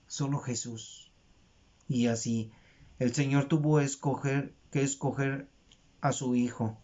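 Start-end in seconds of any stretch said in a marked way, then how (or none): none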